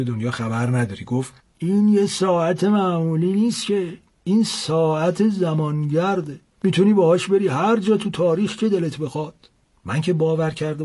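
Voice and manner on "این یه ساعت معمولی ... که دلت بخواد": put-on an old man boasting of a magic watch; old seller's voice. A narrator's reading. Old seller explains the clock.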